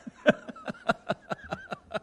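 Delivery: high bursting laugh; real laugh at his own joke. Real, not acted. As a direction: break into a laugh. Laughs after the poem.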